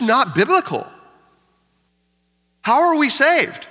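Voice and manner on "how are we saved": rising preaching. Acted. Preaching climax.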